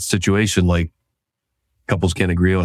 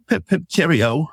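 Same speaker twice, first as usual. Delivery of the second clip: laughing mock posh British accent, higher; 9 semitones higher than usual. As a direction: play the silly English gent in a British accent.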